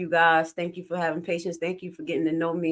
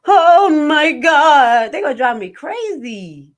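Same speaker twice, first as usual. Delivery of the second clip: exasperated wail; 9 semitones higher, 12 dB louder, slower than usual. Real, not acted. Stressed about Haitian family.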